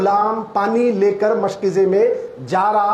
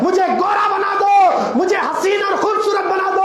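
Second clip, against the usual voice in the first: fervent pulpit cry; preaching at full force; 9 semitones higher than usual. Acted. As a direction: call it out to the whole congregation. Long impassioned preaching run.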